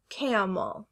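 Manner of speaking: "camel" slowly as KAM-ul, falling; short A. Shown as a demonstration. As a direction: say "camel" with the a of cat. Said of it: "Is it".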